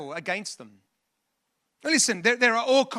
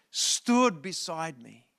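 drawn-out, lower dramatic phrase; slow emphasis; 4 semitones lower, much slower than usual. Retold or imitated. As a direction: stretch the words out deliberately. Dwells on Paul's words, then repeats.

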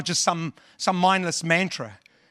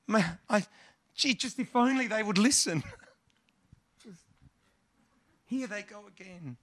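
weary, softer sigh of a fed-up God; exasperated God; 2 semitones higher, 7 dB quieter than usual. Retold or imitated. Pastor voicing God despairing at people, soft weary sigh. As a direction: sigh it out, face in hands. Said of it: "he must have his face in his hands going".

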